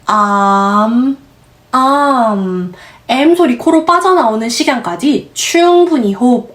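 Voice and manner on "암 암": "암" with the jaw wide open and a long humming m; open am sound. Shown as a demonstration. Teacher showing the open a sound in "암". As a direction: stretch "암" slowly and let the m ring through the nose. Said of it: "턱 크게 열어서 아 소리 넣으셔야".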